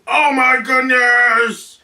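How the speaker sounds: loud, high, drawn-out yell. Shown as a demonstration. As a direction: yell it out slowly, stretching the words. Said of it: "they could be yelling".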